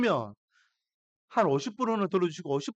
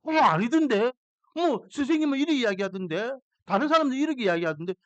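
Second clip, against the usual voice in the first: daughters talking back; 6 semitones higher than usual. Retold or imitated. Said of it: "믿지 않아요".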